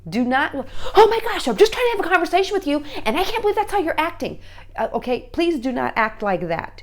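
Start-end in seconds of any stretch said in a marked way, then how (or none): 1.0-4.4 high, loud, rapid exasperated rant; hammed-up indignant scolding; mad parent. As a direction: act out a parent losing their cool. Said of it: "And don't get mad".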